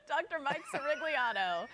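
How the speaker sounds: name rolled out with a laugh; savoring the name, laughing. Real, not acted. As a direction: relish every syllable, giggling. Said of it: "it does roll off the tongue".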